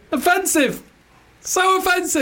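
very high, helpless laughing words; laughing protest. Real, not acted. Co-host scolds comic's accent, high and laughing. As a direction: squeal it through laughter.

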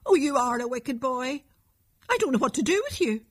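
understated exasperated mother, quick at the end; angry. Acted. Mother despairing over her wicked boy, mildly angry and fast. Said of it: "cried his mother angrily".

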